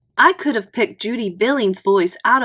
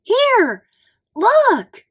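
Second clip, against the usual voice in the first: much higher, louder excited call; calling out excitedly; 13 semitones higher, 5 dB louder than usual. A narrator's reading. Judy spotting a big spider, calling out thrilled. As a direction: call out to him, thrilled by the find. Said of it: "Then Judy called".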